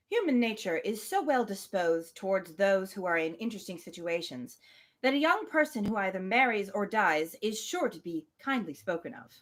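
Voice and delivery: measured reading of a classic novel; story narration. Acted. Podcast host reading Emma aloud, measured narration. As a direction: read it like an audiobook narrator.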